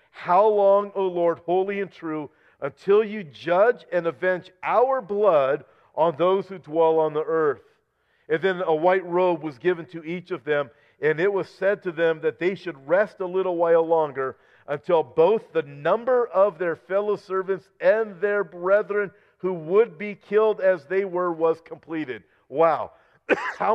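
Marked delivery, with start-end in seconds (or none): 0.1-7.6 higher, louder plea to the Lord; loud cry. A narrator's reading. Martyrs under the altar crying to God, loud pleading cry. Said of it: "they cried with a loud voice". 8.3-22.2 raised, ringing reading of Revelation; scripture reading. Acted. Preacher reading Revelation aloud to his congregation, raised and ringing.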